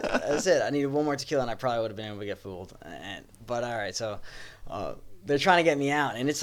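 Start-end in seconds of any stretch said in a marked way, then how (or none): none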